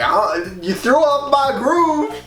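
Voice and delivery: high, loud bratty emperor voice; shrill spoiled royal tantrum; Kuzco. Retold or imitated. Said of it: "He's like".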